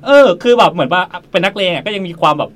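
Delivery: loud, amused storytelling; excited telling. Real, not acted. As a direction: burst out with the surprise of it. Excited retelling.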